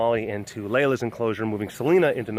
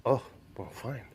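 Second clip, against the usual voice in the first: sulky; 4 semitones lower, 12 dB quieter than usual. Real, not acted. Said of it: "she is ignoring me".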